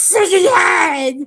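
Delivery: high, loud mock rage swelling on the curse; hammed-up angry outburst, stretched at the end; exaggerated angry retelling. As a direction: imitate a furious storyteller, exploding on "fucking head". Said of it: "This is how angry he got".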